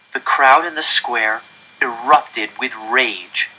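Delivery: ordinary delivery, nothing marked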